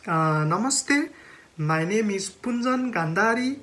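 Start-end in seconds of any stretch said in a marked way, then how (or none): none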